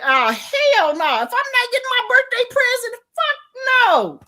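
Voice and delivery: flat refusal that swells to a yell; outraged refusal. Retold or imitated. Retelling her reaction to TT's gift, outraged yell. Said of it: "That's it, my exact words".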